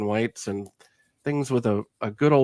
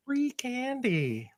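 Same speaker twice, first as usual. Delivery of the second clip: creepy voice; 13 semitones higher, 8 dB quieter, much slower than usual. Pretend. Joke about luring kids.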